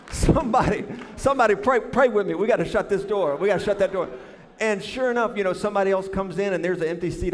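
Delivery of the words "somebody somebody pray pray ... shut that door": loud, higher rallying cry for prayer; hammed-up begging for prayer backup; urgent prayer plea. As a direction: call out loudly as if desperate. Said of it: "I've been praying".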